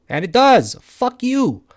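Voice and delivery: sudden loud curse at the chart; angry outburst. Real, not acted. Trader swearing at a price spike, angry burst. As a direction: snap it out, pitch jumping up.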